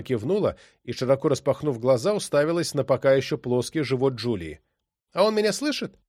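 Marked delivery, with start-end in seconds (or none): 5.1-6.0 put-on high, eager child's question; little girl voice. A narrator's reading. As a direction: ask like a wide-eyed little girl. Little girl asks excitedly.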